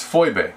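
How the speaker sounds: Phoebe said as FOY-bay; original pronunciation. Shown as a demonstration. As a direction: pronounce Phoebe with an oy, ending in bay. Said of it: "the way to actually pronounce that word".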